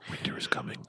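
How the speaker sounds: whisper. Pretend. Quoting Game of Thrones.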